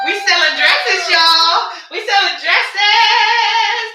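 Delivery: loud, high, sing-song cheer; excited celebration. Real, not acted. Seller cheering a new dress sale to her viewers, excited sing-song. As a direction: belt it out in triumph over a sale.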